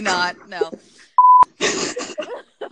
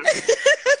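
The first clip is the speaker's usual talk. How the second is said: high laugh; 6 dB louder than usual. Real, not acted. Laughter fit.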